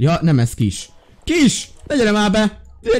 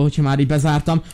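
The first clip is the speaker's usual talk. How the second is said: normal talking voice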